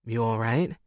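low rough man's voice; rough sexy voice. A narrator's reading. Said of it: "in a rough, sexy voice".

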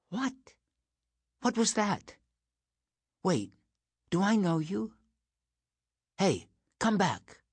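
lightly voiced, high-pitched startled girl; startled girl calling after someone. Girl to someone leaving, startled and calling after them.

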